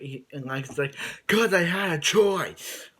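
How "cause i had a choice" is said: loud, high, slow yell as Lex; yelling Lex impression. Lex yells his excuse at his younger self, slow high shout. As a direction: bellow it like a cornered villain. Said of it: "I do my best yelling Lex impression".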